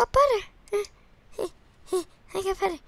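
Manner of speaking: high delighted cry, laughing; giddy laughing shout; excited squeal. Real, not acted. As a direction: squeal with joy over the find. Thrilled to find butter.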